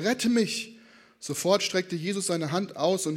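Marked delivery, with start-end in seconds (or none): 0.0-0.8 higher-pitched plea, not shouted; understated cry for rescue; cried out. A narrator's reading. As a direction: read a plea with a touch of urgency. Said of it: "schrie er".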